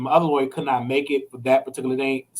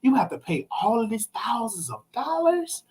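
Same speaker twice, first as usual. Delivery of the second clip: dismayed lawyer; 5 semitones higher, 3 dB quieter, slower than usual. Retold or imitated. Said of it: "he said".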